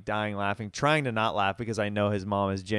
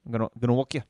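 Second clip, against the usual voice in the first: put-on light South African accent, a bit quick; quick mock South African accent; much faster than usual. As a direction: try a short, sharp South African accent. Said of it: "That's a good accent".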